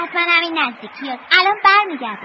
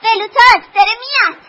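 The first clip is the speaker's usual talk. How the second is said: excited calling out; 5 semitones higher, 11 dB louder than usual. Acted. Children spotting their friend coming, calling out excitedly. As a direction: call out to someone approaching. Children hail arriving Veluta.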